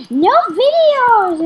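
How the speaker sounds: high, slow, drawn-out shout; mom yelling. Retold or imitated. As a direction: mimic a strict mom forbidding it. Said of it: "my mom's like".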